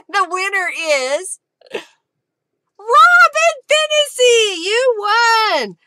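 high, loud, slow winner reveal with a happy cry; loud, delighted cheer for the winner; excited announcement. Real, not acted. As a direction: call out the winner like a game show host.

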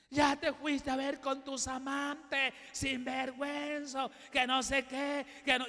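lightly voiced angry wife; nagging wife. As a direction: drag out her accusations like a jealous wife.

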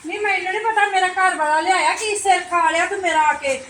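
high, loud exasperated scolding; annoyed outburst. Acted. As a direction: snap at the nosy neighbor. Annoyed outburst at nosy neighbor.

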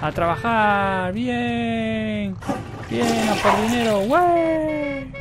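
high, loud, long-drawn hurrah; exuberant cheering. Acted. Cheers the character off to work. (vítores agudos y alargados)

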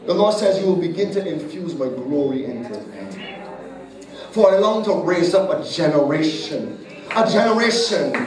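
fervent prophecy shouted over the congregation; preaching at full force. Acted. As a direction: proclaim it over her, rising to a crescendo.

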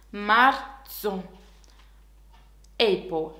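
ordinary talk

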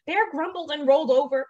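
lively, sing-song storybook delivery; animated picture-book narration; story reading to children. A narrator's reading. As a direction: read it to little ones with big pitch swings.

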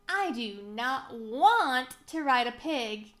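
slow, loud, with WANT drawn out; firm word-by-word declaration, "want" stretched; emphatic refusal. A narrator's reading. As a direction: spell out the refusal, leaning hard on "want". Muddy McCoy declares firmly.

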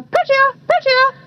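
high parrot chirps; taunting cry. A narrator's reading. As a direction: taunt him in a high bird voice. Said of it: "they cried to taunt him".

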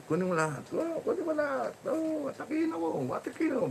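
very high, soft falsetto cooing; mock-pleading squeaky pet talk; playful baby talk. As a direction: coo to a pet in a tiny, high voice.

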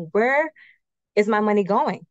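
ordinary talk, nothing marked

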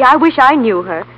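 ordinary delivery

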